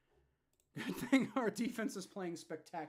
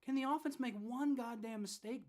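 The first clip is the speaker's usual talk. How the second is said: angry outburst; 9 semitones higher, 4 dB louder than usual. Real, not acted. Fan angry at the defense.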